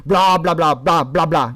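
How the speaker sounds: mock accusatory run of blahs. Shown as a demonstration. Showing how intonation alone sounds accusatory, mock accusing. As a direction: scold in nonsense syllables. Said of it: "If the person sounds accusatory".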